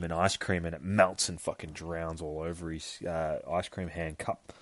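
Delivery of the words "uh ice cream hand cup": plain reading voice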